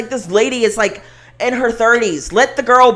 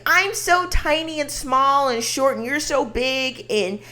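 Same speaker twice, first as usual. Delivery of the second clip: put-on slow, soft, higher breathy-free baby talk; high-pitched baby voice; 5 semitones higher, 4 dB quieter, slower than usual. Mocking Ariana's act toward a tall man, in a soft high baby voice. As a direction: coo it up at someone big. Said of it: "speaking this high-pitched baby voice".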